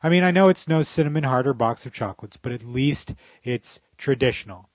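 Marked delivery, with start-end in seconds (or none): none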